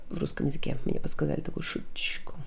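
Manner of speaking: fast, soft whisper; whispered aside. Real, not acted. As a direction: confide it quietly, half joking. Whispered aside about joke. (шёпотом, по секрету)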